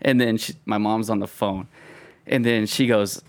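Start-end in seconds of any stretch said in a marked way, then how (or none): none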